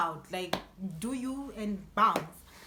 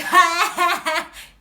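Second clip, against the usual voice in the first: loud, high laugh bursting out; burst of laughter; 7 semitones higher, 14 dB louder than usual. Retold or imitated. She laughs at her friend's blunder, loud burst of laughter. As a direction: crack up at her mistake.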